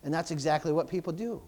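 usual speaking voice

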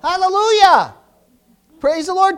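shouted hallelujah, high and loud; loud preaching exclamation. Real, not acted. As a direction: call it out to the church. Preacher exclaiming at full force.

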